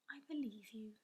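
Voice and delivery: hushed, almost whispered; softly. A narrator's reading. Sophie softly reassuring the offended giant, whispered. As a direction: whisper it gently to reassure him. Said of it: "she said softly".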